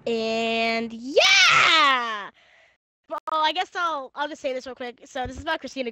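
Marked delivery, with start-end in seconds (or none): 0.1-2.3 loud drawn-out yeah; excited shout. Real, not acted. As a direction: belt out the yeah. Loud cheer before reading.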